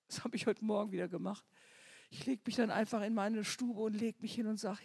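ordinary delivery

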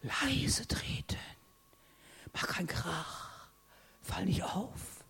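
acted tiptoeing hush; hushed warning. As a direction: warn them to stay unnoticed. Mimicking upbringing to be quiet.

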